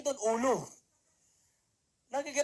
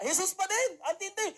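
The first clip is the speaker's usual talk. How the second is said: ordinary talk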